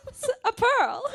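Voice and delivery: loud, high, laughing cry of discovery; excited cry. Acted. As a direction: burst out laughing at the find. Oyster eater cries out finding pearl.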